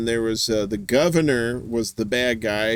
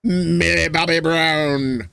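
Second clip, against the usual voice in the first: playful drawl; 3 semitones higher, slower than usual. Pretend. Playfully drawing out actress's name.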